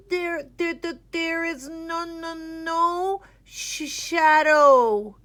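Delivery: high, stammering frightened squeak; nervous squeak. A narrator's reading. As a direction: stutter it out with stage fright. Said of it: "he squeaked".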